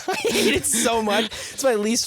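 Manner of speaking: laughing through the words, slowed; cracking up while complaining; laughing and disgusted. Real, not acted. Laughing at gross memory.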